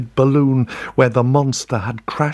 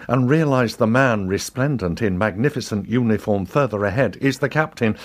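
usual speaking voice

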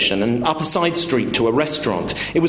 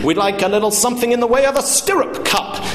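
very high, hammed-up hunting call; boisterous, pompous order across the room; shouting; 13 semitones higher than usual. Father hollers mock hunting talk at waiters, shouting. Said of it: "my father began to shout again".

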